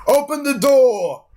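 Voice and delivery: high, slow preacher rallying a mob; priest's call. Retold or imitated. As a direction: rouse the crowd like a fanatic priest. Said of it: "the priest saying".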